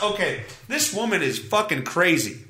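usual speaking voice

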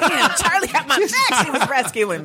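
high laughing exclamation. Real, not acted. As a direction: laugh at being rescued. Laughing relief after being rescued.

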